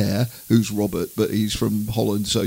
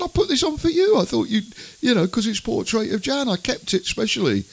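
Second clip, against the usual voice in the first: much higher, wounded innocent tone; innocent husband; 10 semitones higher than usual. Retold or imitated. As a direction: act hurt and innocent, voice up high. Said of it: "I said".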